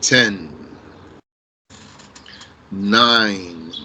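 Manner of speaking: low, drawn-out count with long gaps; slow countdown. Real, not acted. Teacher counts down while students think of an answer, slow countdown. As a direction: count down slowly and low, stretching each number.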